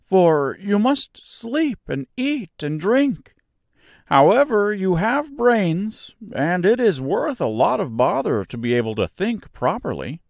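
high, light voice for a straw man; Scarecrow voice. A narrator's reading. Scarecrow pointing out a cottage to Dorothy, high and light. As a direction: play the cheerful Scarecrow up high.

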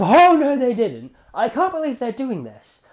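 loud, high outburst of disbelief; shouting at the TV. Retold or imitated. Fan yelling at the TV in disbelief, loud and high. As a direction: yell it at the screen in disbelief. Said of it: "I start shouting to my T V".

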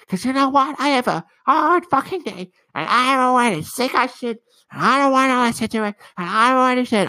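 high, strained mock rant as his mom; hammed-up furious mom voice. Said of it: "And she's like".

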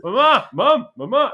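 put-on high, loud childish calling of mama; whiny kid. Kid interrupting mom on the phone, high whiny call. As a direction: call for mama like a needy toddler.

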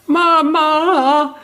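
put-on high, tearful child wailing for mother; little girl sobbing out Mama, bitterly; crying child. A narrator's reading. Injured little girl crying for her mother, wailing. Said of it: "drang es nun bitterlich weinend".

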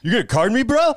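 put-on indignant young drinker. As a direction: act offended at being carded. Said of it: "they'd be like".

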